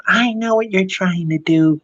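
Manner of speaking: lightly acted angry customer; mock Karen. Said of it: "she starts, like, going".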